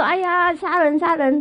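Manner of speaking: put-on high-pitched panicked yelp, half-drunk; alarmed cry of murder. Drunk husband waking to a knife, crying murder in high alarm. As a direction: cry out as if someone's coming to kill you. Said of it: "他说".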